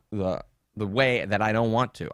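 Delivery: ordinary delivery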